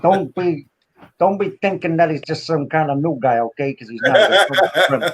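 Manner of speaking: put-on casual tough-guy talk in a New York accent; New York wise guy. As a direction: play a New York mobster. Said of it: "New York voice".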